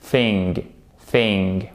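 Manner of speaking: THING started with a soft, voiceless TH; tongue-between-teeth TH on THING. Shown as a demonstration. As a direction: say THING alone, low, with a soft TH. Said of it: "c'est un soft T H".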